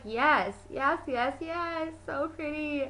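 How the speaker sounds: climbing, softening string of yeses; acted delight. Reacting to the Mockingjay dress, rising delight. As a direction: gush in mock squeaky rapture.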